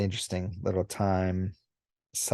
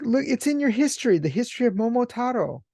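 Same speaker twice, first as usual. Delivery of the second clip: much higher, fast, insistent; insistent alien Oni; 14 semitones higher, faster than usual. Retold or imitated. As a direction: press the point hard. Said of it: "He's like".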